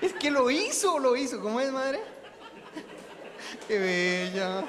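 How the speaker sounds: words bursting out through real laughter; high, loud laughing retell. Real, not acted. Speaker to congregation recalling the funny moment, laughing.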